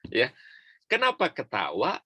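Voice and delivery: ordinary delivery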